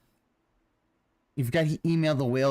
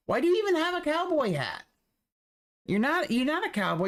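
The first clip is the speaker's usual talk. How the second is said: incredulous rant; 10 semitones higher than usual. Real, not acted. Ranting at Phil.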